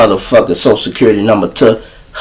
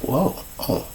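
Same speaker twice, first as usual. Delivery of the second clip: stunned quiet; 3 semitones higher, 15 dB quieter than usual. Retold or imitated. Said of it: "She like".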